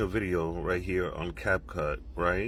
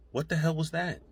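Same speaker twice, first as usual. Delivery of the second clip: startled; 9 semitones higher than usual. Real, not acted. Hears unexplained voice in video.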